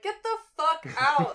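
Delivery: loud, high mock order to leave; joking yell. Bartender to regulars walking in at the bar, joking yell. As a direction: holler it playfully, laughter close behind.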